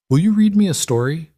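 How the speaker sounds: put-on high, eager little girl asking; pleading child. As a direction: ask like a hopeful kid. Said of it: "Lily asked".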